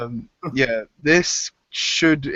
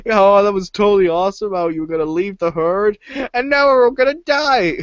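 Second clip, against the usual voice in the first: rising to a high loud wail; panicked sarcasm; 8 semitones higher, 4 dB louder than usual. Retold or imitated. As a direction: build from mock cheer into panic. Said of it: "he's like".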